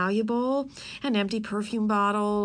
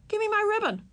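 put-on much higher little-girl demand; 13 semitones higher than usual. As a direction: insist it's hers.

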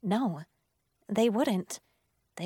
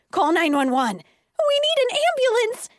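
high, loud cry for help; frantic, hysterical shouting; panicked screaming; 13 semitones higher, 6 dB louder than usual. A narrator's reading. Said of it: "everyone started screaming".

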